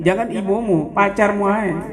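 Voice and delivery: usual speaking voice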